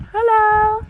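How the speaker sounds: squeaky high hello to a kitty; high-pitched pet talk; high cooing hello. Real, not acted. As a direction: sing it up high like greeting a pet. Cooing at a cat.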